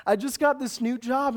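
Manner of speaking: ordinary delivery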